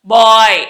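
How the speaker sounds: బాయ్ loud and drawn out on an open aa-y; emphatic model of బాయ్; BOY with aa-y. Shown as a demonstration. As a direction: press the B, open the vowel in బాయ్. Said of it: "ఆయ్ అన్నట్టుగా పలకాలి".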